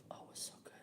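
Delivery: hushed "so good" aside; whispered aside. Real, not acted. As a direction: breathe it like a secret delight. Aside about the meatloaf.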